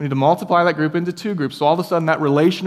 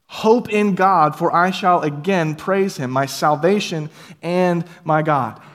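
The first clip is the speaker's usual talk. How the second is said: drawn-out, measured psalm refrain; slow solemn scripture reading; 2 semitones higher, 3 dB louder, slower than usual. Acted. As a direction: intone it slowly like a chorus. Psalm refrain read slowly.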